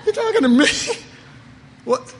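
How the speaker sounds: you can't believe he's calling you out; startled disbelief. Retold or imitated. Called up front by the Lord, a high, loud startled squeak.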